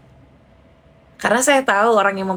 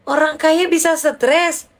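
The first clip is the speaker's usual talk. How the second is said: teasing; 7 semitones higher, slower than usual. Retold or imitated. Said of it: "Saya bercandain kan".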